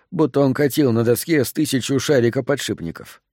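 ordinary delivery

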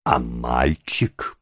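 low, slow, sly question; wheedling, unhurried inquiry; ingratiating. A narrator's reading. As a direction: probe gently in a drawn-out low voice. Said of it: "самым заискивающим тоном".

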